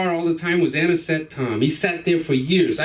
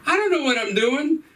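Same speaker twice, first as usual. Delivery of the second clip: put-on bewildered kid; 7 semitones higher than usual. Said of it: "And I'm thinking".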